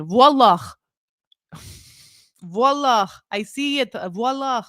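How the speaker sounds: high-pitched put-on Lebanese "Voila"; mock Lebanese accent. Joking about saying voila like a Lebanese person, mock Lebanese accent. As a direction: exclaim it with a playful Lebanese accent. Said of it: "saying it like a Lebanese person".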